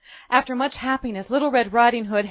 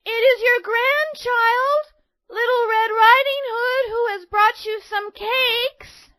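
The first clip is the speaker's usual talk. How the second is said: high, louder, slow call through a door; 13 semitones higher, 6 dB louder than usual. A narrator's reading. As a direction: call out to granny inside. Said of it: "she called out".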